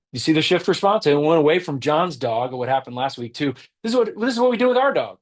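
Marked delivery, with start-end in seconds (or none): 3.8-5.2 quick, much higher voice of a neighbour bragging about her own dog; self-centred brag. Acted. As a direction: rattle it off fast, all about yourself. Shift response example, Mary.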